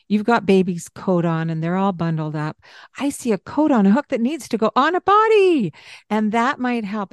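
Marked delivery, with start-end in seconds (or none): 3.0-5.7 higher, bright and playful; exciting tone. Shown as a demonstration. Parent to child, coat on, excited voice. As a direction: make it a fun little game. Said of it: "a tone of voice that's exciting".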